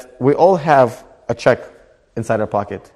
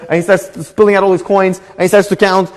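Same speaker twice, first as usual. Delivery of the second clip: ordinary delivery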